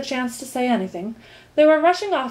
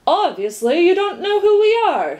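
high, loud, haughty sneer; 10 semitones higher, 6 dB louder than usual. A narrator's reading. Fifth minister puts Milo in his place, sneering. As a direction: put him down with emphatic disdain. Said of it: "sneered the fifth".

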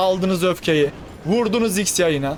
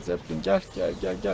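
low, hushed coaxing; 11 semitones lower, 7 dB quieter than usual. Real, not acted. Urging the zeppelin on.

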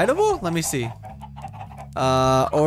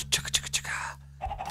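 whispering; 10 dB quieter than usual. Pretend. Whispered chugga chant to chickens.

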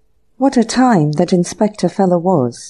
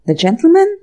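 higher, sharply rising question; puzzled echo; 10 semitones higher, 3 dB louder than usual. A narrator's reading. Anthony questions the servant's mention of visitors, puzzled echo. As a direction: echo it back in surprise.

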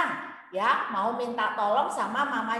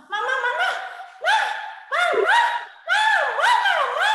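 put-on high, loud child calling Mama over and over; a child pestering mother for attention; whiny child calling; 4 dB louder, faster than usual. Child interrupting his mother, high insistent calling. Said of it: "Dia, dia bilang gitu".

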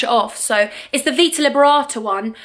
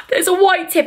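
higher excited exclaim; mock surprise; 7 semitones higher than usual. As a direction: act thrilled at a nail tip. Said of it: "I'm like".

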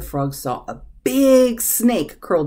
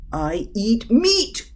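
slow dog voice, higher at the end; growling dog; 6 semitones higher, slower than usual. A narrator's reading. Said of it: "growled the dog".

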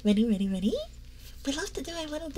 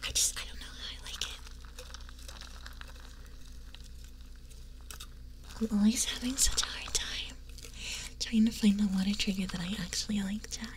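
ASMR whisper; 3 semitones lower, 6 dB quieter than usual. Acted.

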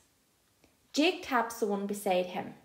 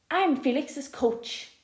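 plain reading voice

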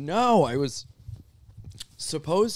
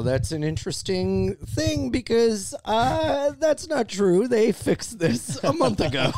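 high, slow mock-offended protest; high defensive; 10 semitones higher, slower than usual. Real, not acted. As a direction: squeak out a defensive denial.

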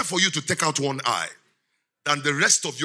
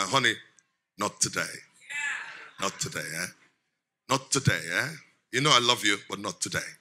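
gentle refusal; 8 semitones lower, 6 dB quieter than usual. Acted. Husband gently refusing wife, acted.

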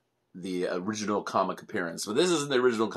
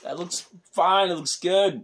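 playful protest; 9 semitones higher, 4 dB louder than usual. Real, not acted. Father defends his favourite figure.

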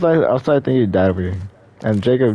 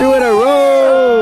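excited shout; 10 semitones higher, 5 dB louder, slower than usual. Real, not acted. Hype after kill.

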